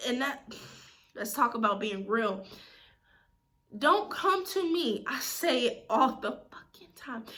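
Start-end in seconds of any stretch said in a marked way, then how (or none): 3.8-5.0 higher, slow, stressed DONT; firm slow warning; emphatic warning. Real, not acted. 5.1-7.2 whispered, under the breath; hushed frustrated aside. Real, not acted. Aside while venting.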